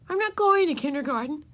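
high-pitched stubborn refusal. A narrator's reading. Ramona to the yard teacher, stubbornly. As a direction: dig in and refuse. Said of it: "said Ramona stubbornly".

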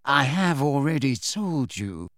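slow, smooth and cool; calm, unhurried Snape. A narrator's reading. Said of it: "said Snape smoothly".

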